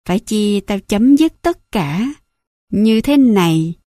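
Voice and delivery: slow, every word hit one by one; "Phải chi tao chấm dứt tất cả như thế này" spaced and slow, each syllable leaned on; each word stressed. A narrator's reading. Mother threatening her son in his ear, slow and weighted. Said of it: "Mẹ nhấn mạnh từng từ".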